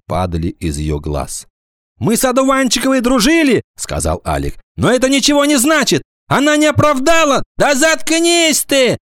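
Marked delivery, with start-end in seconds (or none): 2.0-3.6 high-pitched schoolboy outburst; high, insistent boy's voice; agitated protest. A narrator's reading. Boy Alik protests to the teacher. 4.8-7.4 high, urgent schoolboy voice; insistent high-pitched outburst; agitated protest. A narrator's reading. Boy Alik keeps protesting. 7.6-9.0 high, drawn-out angry retort; cross schoolgirl's outburst; angry snap. A narrator's reading. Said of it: "вдруг зло сказала Гвоздёва".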